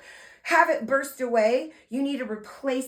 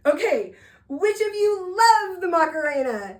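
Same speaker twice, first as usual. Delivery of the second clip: high, loud hype-host question; re-enacted crowd rouser, pitched up; hyping a crowd; 6 semitones higher, 5 dB louder than usual. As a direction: work up a big room of kids. Re-enacts asking a crowd of kids.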